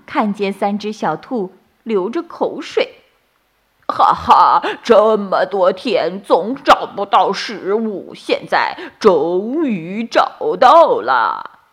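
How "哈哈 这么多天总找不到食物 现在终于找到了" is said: loud, slow greedy wolf voice; gloating wolf. A narrator's reading. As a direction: gloat over found prey like a hungry wolf. (饿狼得意的低吼)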